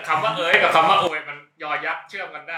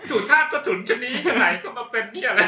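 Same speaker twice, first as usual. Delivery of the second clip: mock theatrical classical Thai, a little higher; reading an old literary insult; 7 semitones higher than usual. Reading a literary insult to friends, hammed-up classical scolding. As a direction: ham up an old-fashioned scolding line. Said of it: "เดี๋ยวผมจะอ่านคำด่าจากวรรณคดีให้".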